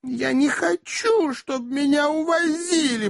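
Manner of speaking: very high, slow feminine voice; whining spinster aunt. A narrator's reading. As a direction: moan it plaintively up high. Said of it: "пробормотала незамужняя тётушка". (капризная тётушка, высоко и протяжно)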